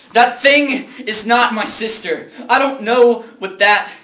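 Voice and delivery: high, loud, emphatic denial; anguished outburst. Acted. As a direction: cry out in horrified refusal. Brother rejects sight of sister's body.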